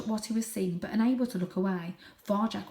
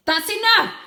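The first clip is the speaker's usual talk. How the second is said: high, loud angry outburst; furious blaze; 14 semitones higher, 14 dB louder than usual. Acted. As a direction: slam it shut. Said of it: "blazed Father".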